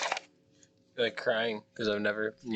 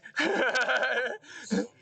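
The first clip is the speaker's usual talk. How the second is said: high-pitched sustained laugh; 14 semitones higher than usual. Real, not acted. Player laughs at a joke about dying, high laughter. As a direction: crack up in high giggles.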